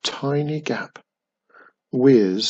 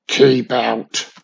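louder, mildly menacing warning; unpleasant muffled warning; 6 dB louder than usual. A narrator's reading. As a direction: warn off an unwelcome visitor. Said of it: "a muffled voice warned unpleasantly".

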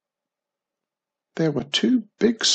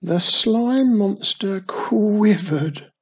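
ordinary delivery

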